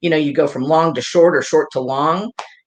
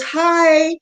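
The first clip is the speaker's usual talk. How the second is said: bright high squeaky greeting; mock sweet hello, high; high chirpy hi; 3 dB louder than usual. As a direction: pipe up high and cheerful. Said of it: "she goes".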